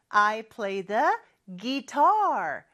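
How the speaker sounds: "guitar" stressed on "tar", gi-TAR; slow model line, "guitar" stressed at the end; stress on second syllable. Shown as a demonstration. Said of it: "이음절에 강세가 있죠".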